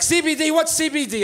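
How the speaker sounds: high-pitched imitation of immigrant mom; puzzled mother. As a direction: ask like a baffled mother. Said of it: "and her being like".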